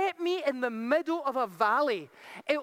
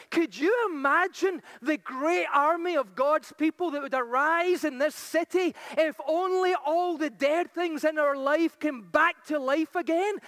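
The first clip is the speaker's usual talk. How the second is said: normal talking voice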